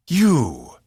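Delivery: loud seething accusation. A narrator's reading. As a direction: spit the word out in fury. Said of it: "Zenfor seethed".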